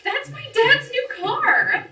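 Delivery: horrified yell at the smashed car; shocked shriek. Acted. Window smashed, girl shrieks.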